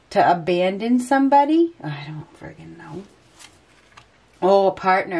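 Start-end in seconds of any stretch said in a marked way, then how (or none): 1.8-3.0 much softer, dropped under the breath; muttered aside. Real, not acted. Tarot reader stumped by the cards, muttering softly to herself. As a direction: grumble it to yourself, giving up. Exasperated aside during reading.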